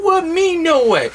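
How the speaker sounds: high-pitched feigned shock; mock innocence. Undercover cop denies he is a cop, mock innocence.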